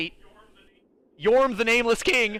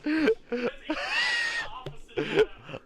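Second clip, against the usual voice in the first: long, high, shrieking laugh; 4 dB quieter than usual. Real, not acted. Breaks into loud laughter at own joke.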